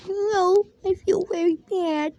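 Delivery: little sister too sick to cope; crying child. Acted. Sick sister asked if okay.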